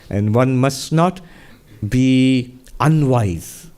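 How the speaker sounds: slow emphatic. Acted. Emphatic sermon warning.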